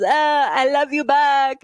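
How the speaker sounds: mocking drunk-toddler impression, pitched high; Kamala Harris. Host ridiculing her as immature, drunk toddler voice. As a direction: exaggerate a fake accent like a tipsy toddler. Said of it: "acting like she's a drunk toddler".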